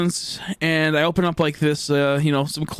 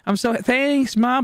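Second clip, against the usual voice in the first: pretend happy; 7 semitones higher, slower than usual. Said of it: "trying to pretend pretty happy".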